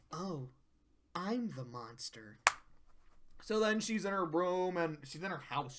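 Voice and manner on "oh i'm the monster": much softer, lower, slow; dawning dismay. Retold or imitated. Fluttershy realizing her fault, soft dismayed murmur. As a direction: let it sink in.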